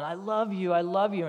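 acted high, loud cry of love; excited retold yell. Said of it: "she's yelling".

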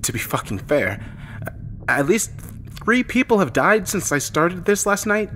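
worked-up confession to a log; agitated narrator. Acted. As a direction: let it burst out in uneven surges. Distraught log entry opens the episode.

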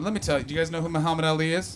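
ordinary delivery

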